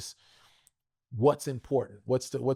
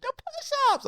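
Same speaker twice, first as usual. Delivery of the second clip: put-on much higher, eager little kid answer; youngest son's voice; slower than usual. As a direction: pitch it up like a proud child. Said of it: "my youngest went".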